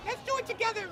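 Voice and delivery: ordinary delivery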